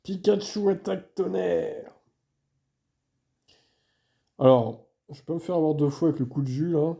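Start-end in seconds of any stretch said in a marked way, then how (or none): 0.0-1.9 slow, high command shout; anime trainer call. Pretend. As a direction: call the attack like an anime hero. Calling the attack like a trainer.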